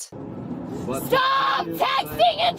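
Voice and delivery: real drawn-out, very high-pitched screams; screaming. Real, not acted. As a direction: scream each command at the driver, stretching it. Screaming at officer during transport.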